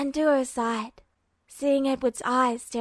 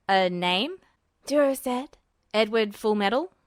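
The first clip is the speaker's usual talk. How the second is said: Duo's voice; 2 semitones lower than usual. A narrator's reading. Duo voiced, puzzled.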